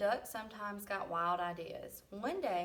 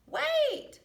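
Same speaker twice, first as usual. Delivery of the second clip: much higher and louder cry; cried out; 9 dB louder than usual. A narrator's reading. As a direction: call out desperately after the tractor. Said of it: "cried Sheep".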